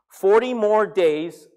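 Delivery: loud, slow declaration; booming, drawn-out prophet's warning; prophetic proclamation. Retold or imitated. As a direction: proclaim it to a whole city, word by word. Repeats Jonah's sermon to Nineveh.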